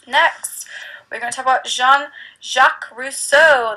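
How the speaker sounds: the name Rousseau said with a showy French accent, Jean-Jacques as zhahn-zhahk; a teacher's hammed-up French pronunciation of Jean-Jacques Rousseau; playful French accent. Shown as a demonstration. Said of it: "That was my best French accent".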